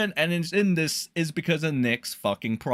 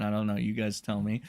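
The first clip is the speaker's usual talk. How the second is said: ordinary talk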